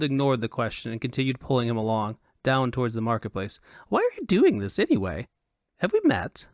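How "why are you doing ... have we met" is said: high-pitched, excitable foppish voice; high and animated Elvunian dandy; high Elvunian fop. A narrator's reading. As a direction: play a foolish fop in a high, affected register. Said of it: "he said in a high Elvunian accent".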